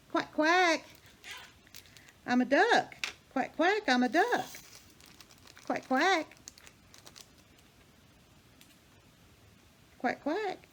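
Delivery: high, loud quacking duck voice; silly duck voice to a pet; duck imitation. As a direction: quack playfully like a cartoon duck.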